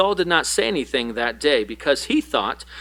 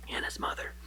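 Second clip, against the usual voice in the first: whispered; 15 dB quieter, faster than usual. Acted. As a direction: drop to a breathy whisper. Aside ending reading.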